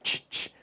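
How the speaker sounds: "치" voiceless, just the opening sound of chair; isolated consonant sound. Shown as a demonstration. Teacher showing the first sound of chair, voiceless "치". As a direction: sound out "치" twice without voice. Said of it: "첫 소리가 자음으로 발음되는".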